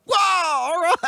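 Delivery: high shouted whoa, all right; loud whoa. Retold or imitated. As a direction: shout it out, wowed. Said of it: "Hope I wasn't too loud right there".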